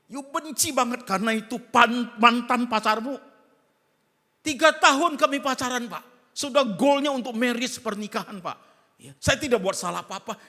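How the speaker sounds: loud, high complaining outburst; wronged churchgoer venting loudly to the pastor; upset congregant. Acted. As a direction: pour out the hurt to the pastor, loudly. Congregant pouring out grievance to pastor.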